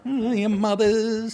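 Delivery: high, slow put-on sassy voice; a lightly voiced sassy woman; mock female retort. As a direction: sneer it in a mocking voice.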